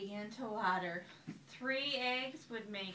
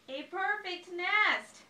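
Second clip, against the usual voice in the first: delighted; 8 semitones higher, 9 dB louder, slower than usual. A narrator's reading. Chicken admires the nest.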